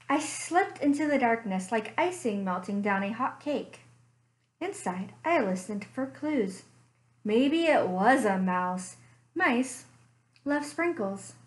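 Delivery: story narration. A narrator's reading. Librarian reading a bear story to children, gentle storytelling. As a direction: draw the kids into a cozy mystery tale.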